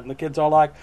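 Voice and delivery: usual speaking voice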